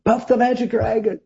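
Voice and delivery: high-pitched audience song request; calling out. Retold or imitated. Concertgoers requesting their favourite song, called out. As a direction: call it out high, like a fan at a show. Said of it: "people would always call out".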